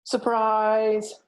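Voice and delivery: high, loud cheerful Surprise; surprise shout. A narrator's reading. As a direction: shout it out joyfully to greet him. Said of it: "came the cry".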